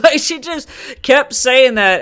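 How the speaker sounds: high, loud, drawn out through laughter; laughing. Real, not acted. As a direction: tell it while cracking up. Laughing while retelling the call.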